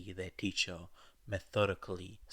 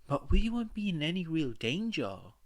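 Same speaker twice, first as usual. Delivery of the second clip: higher, louder objection; protesting; 10 semitones higher, 6 dB louder than usual. A narrator's reading. Ochako objecting to One's refusal, loud protest. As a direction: push back hard, protesting. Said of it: "protested Ochako".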